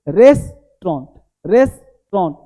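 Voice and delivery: रेस्टोरेंट in two chunks, "res... tron"; correct pronunciation. Shown as a demonstration. Teacher models the right form, carefully split. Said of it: "ये सही है".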